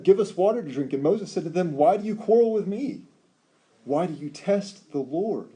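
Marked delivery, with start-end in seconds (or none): 0.0-0.9 high, loud mock demand; quarrelsome demand. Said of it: "the people quarreled with Moses". 1.8-5.5 high, loud rebuke slowing at the end; rebuking Moses. Retold or imitated.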